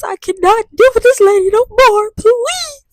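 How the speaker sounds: high, pleading prayer; hammed-up plea to God; mock desperate plea. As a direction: beg God, over the top. Praying the quiz stays easy.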